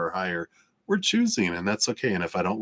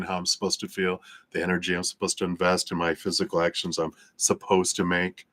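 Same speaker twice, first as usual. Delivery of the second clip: ordinary talk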